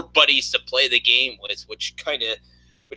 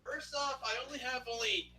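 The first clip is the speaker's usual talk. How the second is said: very soft, high-pitched excuse; meek protest; 9 semitones higher, 20 dB quieter than usual. Real, not acted. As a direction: protest timidly under your breath. Meek reply to angry friend.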